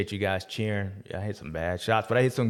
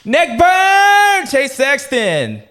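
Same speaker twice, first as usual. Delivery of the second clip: much higher, loud, stretched announcer call; hype announcer shout; 11 dB louder, much slower than usual. Acted. Naming his number one rider, a loud announcer call. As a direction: call the winner's name like a ring announcer.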